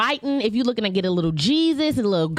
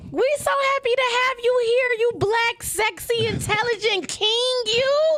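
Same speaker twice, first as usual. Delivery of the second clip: high, playful flattery; gushing; 11 semitones higher than usual. Acted. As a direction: lay the compliments on thick. Gushing praise of guest.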